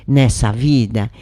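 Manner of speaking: ordinary delivery, nothing marked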